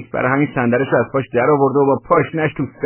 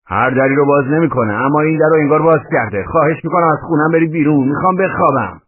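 louder, higher argumentative retort; heated, raised-voice objection; indignant protest; 6 semitones higher, 6 dB louder than usual. A narrator's reading. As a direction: push back firmly at an intruder. Tenant argues with intruder.

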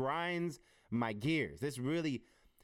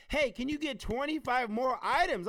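high, loud, drawn-out request; mock phone nag, pitched way up; breezy big ask in a put-on high voice; imitated wife calling; 12 semitones higher, 6 dB louder than usual. Wife's phone request acted out.

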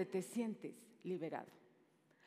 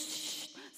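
sharp hissed "shh"; acted shushing sound; silencing shush; 6 dB quieter than usual. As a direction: hush the negative voices. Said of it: "calla esas voces".

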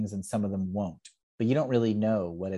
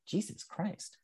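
high, hushed exclamation; mock astonishment; 10 semitones higher, 9 dB quieter, slower than usual. Improviser recalls being recognized by a fan, mock astonishment. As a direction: say it small and high, as if amazed.

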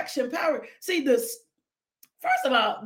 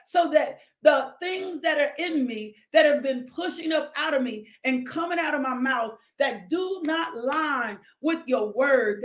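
usual speaking voice

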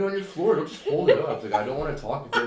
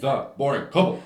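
higher, louder, rising title; excited; 5 semitones higher, 8 dB louder than usual. Shown as a demonstration. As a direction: announce it with a rising lift. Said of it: "the second time was the best time".

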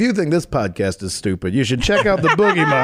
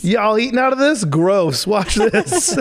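brash party guy, a bit higher; 7 semitones higher, slower than usual. Retold or imitated. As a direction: taunt like the prankster guy. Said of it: "that's what the guy with his ass out".

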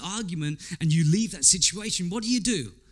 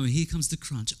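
ordinary talk, nothing marked

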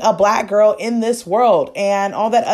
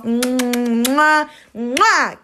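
between kisses. Real, not acted. Blowing kisses at sign-off.

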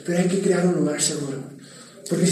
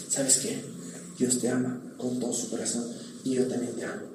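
much softer, gentle and loving; tender, soft reassurance; 7 semitones lower, 13 dB quieter than usual. Acted. Pastor showing how to tell someone God loves them, soft and tender. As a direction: say it like a quiet promise.

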